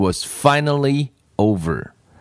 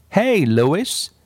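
mild higher-pitched call to a friend; read-aloud shout, kept gentle; 7 semitones higher than usual. A narrator's reading. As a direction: call out lightly, pitched up without real volume. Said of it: "Damon shouted".

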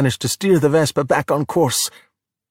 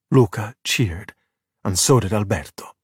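plain reading voice

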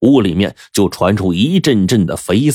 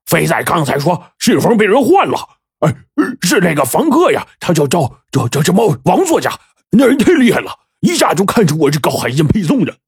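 high, eager blurting; loud and excited; 10 semitones higher than usual. A narrator's reading. Henchman excitedly tells his boss about the writer, high and eager. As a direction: blurt out the sudden idea. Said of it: "大声说".

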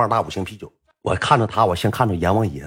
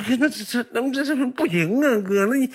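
scared pleading; 14 semitones higher than usual. Retold or imitated. Scared kid pleads with bully.

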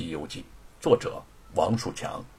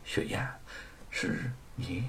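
hushed, softer voice, almost breathless; softly; 3 semitones lower, 13 dB quieter than usual. A narrator's reading. Wu Cheng'en to the woman he recognizes, hushed. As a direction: say her name under your breath in disbelief. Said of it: "轻声地说".

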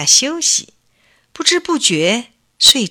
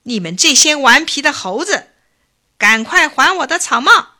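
louder, scolding call up to the tree; angry shout; 4 semitones higher, 5 dB louder than usual. A narrator's reading. Old hat seller yelling at the monkeys in the tree, angry. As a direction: demand the hats back, fed up. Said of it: "老头儿很生气，指着猴子们大声说".

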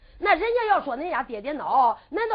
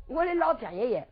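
plain reading voice

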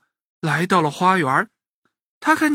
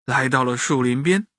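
ordinary delivery

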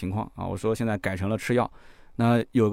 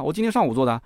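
ordinary talk, nothing marked

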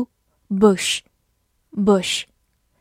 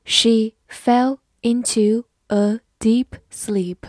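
slow, even reading pace; careful slow model reading; slower than usual. Shown as a demonstration. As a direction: read it slowly word by word. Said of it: "好，慢慢来读。".

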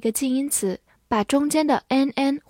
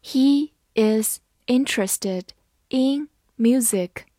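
word by word with gaps between words; careful, evenly spaced teaching pace; slow reading; slower than usual. Shown as a demonstration. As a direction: read it out slowly for learners. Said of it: "好，慢慢来读".

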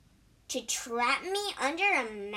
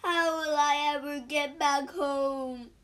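a touch of tearful complaint; tearful wail; 2 semitones higher than usual. A narrator's reading. Injured old monk far from home, mildly tearful wail. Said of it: "he started to cry".

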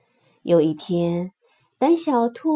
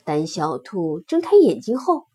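ordinary delivery

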